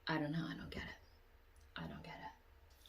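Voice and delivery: faded whispered trailing off; whispered bafflement. Real, not acted. Vlogger whispering to herself in confusion. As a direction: mutter it to yourself. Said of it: "confused aside".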